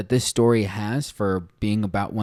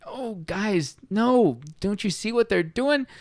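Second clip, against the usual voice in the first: high, anguished plea; pleading; 10 semitones higher, slower than usual. Pretend. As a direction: beg the animals to wake up. Reader pleading with the animals.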